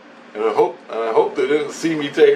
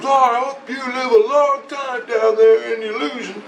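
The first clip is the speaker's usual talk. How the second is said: very high mocking holler; retold yelling; 12 semitones higher than usual. Voice wishing him a long life in illusion, mock yelling. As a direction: imitate his yelling in a high, put-on voice. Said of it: "he's yelling at me".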